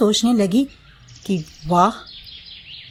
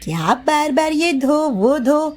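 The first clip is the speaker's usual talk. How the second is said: higher, whiny complaint; high lazy bahu whining; lazy daughter-in-law grumbling; 7 semitones higher than usual.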